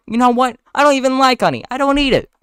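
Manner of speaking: high, loud indignant protest; indignant outburst. Acted. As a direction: protest in a rising huff. Ken argues with Vanessa, acted.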